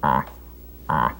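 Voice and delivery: short, low buck grunts; deep buck grunt. Shown as a demonstration. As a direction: imitate an older deer's deep grunt. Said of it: "would have a deeper grunt".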